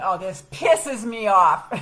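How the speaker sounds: loud, slow, fed-up exclamation; loud slow mock anger at the repair quote; exasperated outburst. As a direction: blurt out your frustration, drawn out. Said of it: "And that was when I said".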